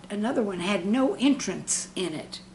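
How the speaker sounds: ordinary talk, nothing marked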